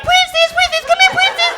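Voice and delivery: very high, loud sing-song calling; high dog call. Pretend. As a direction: squeal for the dog in a tiny high voice. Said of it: "'Cause I can't be like".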